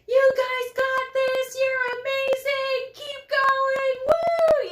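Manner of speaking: high, loud, slow sing-song cheering; high slow cheer from the sidelines; cheering runners on. Retold or imitated. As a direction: cheer them on like a race-day volunteer. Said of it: "you can hear me say".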